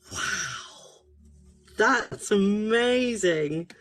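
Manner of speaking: ordinary talk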